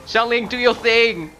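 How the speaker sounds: high, loud battle call; yelling. Real, not acted. Player sends Xiangling into the fight, yelling. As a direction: shout the order out high. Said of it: "Sorry for screaming".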